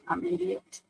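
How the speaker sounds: breathy whisper to herself; whispered self-scolding. Real, not acted. Realising her own mix-up, whispered to herself. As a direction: whisper it, kicking yourself.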